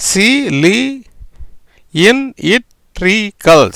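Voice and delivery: சி, லி, இன், இட், ரி, கல் with pauses between; teacher breaking cylindrical into its sounds; syllable by syllable. Shown as a demonstration. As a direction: sound out each syllable separately. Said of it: "எப்படி வருது பாருங்க".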